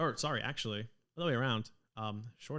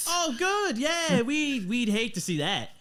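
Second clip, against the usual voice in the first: much higher, louder Australian reunion; excited Australian dwarf; 11 semitones higher, 5 dB louder than usual. Acted. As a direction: gush like an Aussie seeing old friends. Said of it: "her accent has always been the same".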